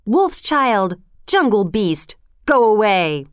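mildly hostile storybook jeers; lightly acted angry crowd call; villagers' shout. A narrator's reading. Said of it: "the villagers shouted".

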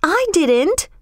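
put-on high indignant girl's denial; sudden high-pitched denial; bursting out. A narrator's reading. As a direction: burst out in protest. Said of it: "burst out Kismeen".

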